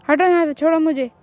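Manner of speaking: loud, pulling away, angry; angry yell. Acted. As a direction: shake him off with a shout. Struggling to break free. (छुड़ाते हुए गुस्से में चिल्लाना)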